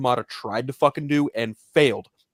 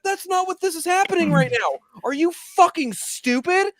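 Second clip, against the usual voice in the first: high-pitched incredulous outburst; exasperated retort. Retold or imitated. Retelling his retort to an angry Discord fan, exasperated. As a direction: strain upward in acted disbelief.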